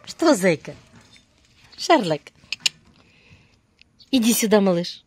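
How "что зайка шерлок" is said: ordinary talk, nothing marked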